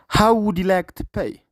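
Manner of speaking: slow, careful English with stress on room; slow repeat for learners. Shown as a demonstration. Teacher repeating a clip line slowly for students, slow and careful. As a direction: say it slowly so the class can copy.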